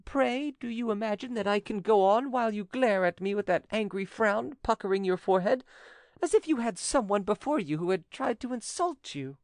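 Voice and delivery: high-pitched, aggrieved woman; reproachful widow. Acted. Widow objects to the notary's frown, high reproach. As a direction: scold him lightly in a high voice.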